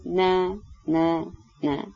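soft, pained refusal; deeply pained. A narrator's reading. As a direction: let each no ache and fade. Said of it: "با لحنی بی نهایت دردناک گفت".

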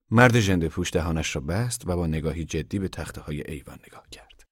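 plain reading voice

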